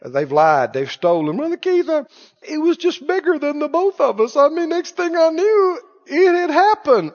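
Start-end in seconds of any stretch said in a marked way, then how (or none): 1.4-7.1 high, wheedling excuse voice; mock the excuse-maker up high; whiny excuse. Pastor mocking a member's excuse: high whining.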